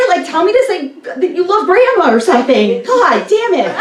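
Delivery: high, loud exasperated rant through laughter; ranting, half furious, half cracking up; laughing mock rage. Real, not acted. Comedian rants about grandpa's driving comment, high laughing exasperation. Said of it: "Oh, I'm so mad".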